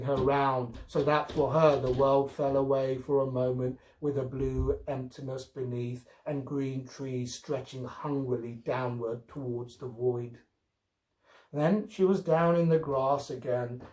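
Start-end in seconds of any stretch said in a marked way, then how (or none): none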